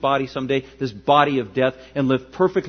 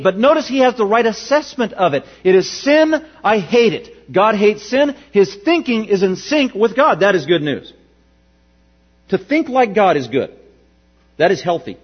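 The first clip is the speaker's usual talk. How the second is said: louder, higher preaching voice, pressing each point; full-force preaching; 7 semitones higher, 5 dB louder than usual. Acted.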